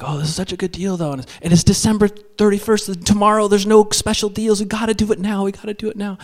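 high, excited wife urging; pleading urgency. Acted. Wife pushing for the deal before tomorrow, high pleading urgency. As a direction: press hard before the chance is gone.

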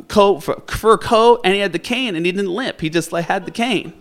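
ordinary talk

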